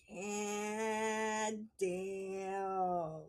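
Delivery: long drawn-out, low monotone; mock dumbstruck drawl; stunned realisation. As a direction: stretch both words out, low and soft. Stunned reaction to mother's verdict.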